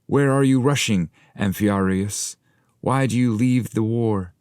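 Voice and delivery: high, loud crowd cry. Acted. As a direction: call after him as he flees the war. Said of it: "they all cried".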